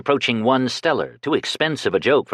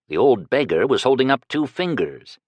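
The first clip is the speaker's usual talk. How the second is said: ordinary delivery, nothing marked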